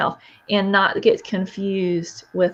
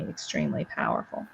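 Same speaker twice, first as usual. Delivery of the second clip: whispered emphasis; 9 dB quieter than usual. Real, not acted. Stressing how powerful one step is.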